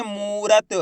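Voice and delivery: "मूरत" with a stretched "moo"; drawn-out long vowel. Shown as a demonstration. Teacher blending letters for learners, long vowel drawn out. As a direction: hold the "oo" of "मूरत", syllable by syllable. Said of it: "कैसे पढ़ेंगे".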